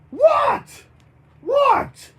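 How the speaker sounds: high, loud yelps of disbelief; yelled. Acted. Bert reacts to the changing hands, high yelling. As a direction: shriek each word separately. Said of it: "Bert yelled".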